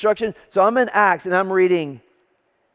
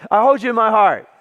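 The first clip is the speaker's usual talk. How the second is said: louder, ringing scripture reading; preaching declaration; 3 semitones higher, 5 dB louder than usual. Retold or imitated. Resuming Paul's letter for the congregation, loud and declarative. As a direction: proclaim it to the whole room.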